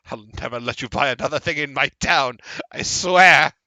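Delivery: fast, rising to a loud high yell; a sulky merchant screaming a threat; angry yell. Acted. Merchant yells a sulky threat at the woman.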